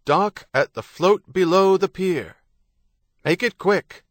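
loud, high bawl over a loudhailer; bawled order. A narrator's reading. Loudhailer ordering a boat to dock at the float, bawled. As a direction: bark it out across the water. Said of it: "The loud hailer behind him bawled".

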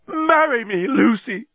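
high, impassioned proposal; crying out. A narrator's reading. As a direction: beg wildly at high pitch. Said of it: "cried the journalist".

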